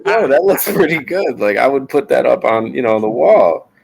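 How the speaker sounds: high, hammed-up praise; mock enthusiasm. Dad praising his son's drawing, high mock enthusiasm. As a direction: pump it up for the kid. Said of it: "But I'm like".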